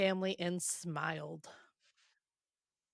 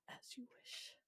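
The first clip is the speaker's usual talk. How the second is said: whispered, dreamy echo of a movie line; very quiet whispered quotation; hushed film quote; 21 dB quieter than usual. Acted. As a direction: breathe it out in a soft whisper. Echoes the quote, thinking of Princess Bride.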